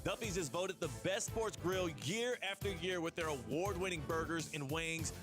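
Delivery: ordinary delivery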